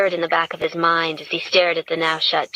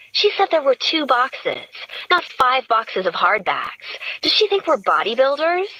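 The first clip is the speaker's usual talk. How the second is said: mock indignation about the boxes; lightly voiced Gretchen; 7 semitones higher than usual.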